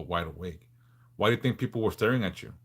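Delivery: normal talking voice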